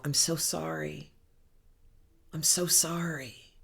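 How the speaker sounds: soft, gentle self-consoling; soothing self-compassion. Shown as a demonstration. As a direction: murmur it softly while rocking yourself. Demonstrates hugging and soothing yourself.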